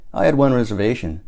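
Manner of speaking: ordinary delivery, nothing marked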